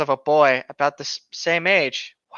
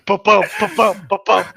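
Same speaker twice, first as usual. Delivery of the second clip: heartbeat imitation; 2 semitones higher, 6 dB louder than usual. Imitating pulsing eyes.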